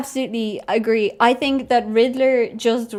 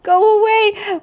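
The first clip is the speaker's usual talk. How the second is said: high, loud protest; exasperated high yell; frustrated shout; 8 semitones higher, 6 dB louder, slower than usual. Real, not acted. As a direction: yell at a nuisance to clear off. Yelling at pop-up blocking message.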